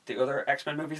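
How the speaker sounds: ordinary talk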